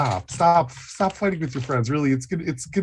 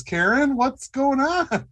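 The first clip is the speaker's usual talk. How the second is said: usual speaking voice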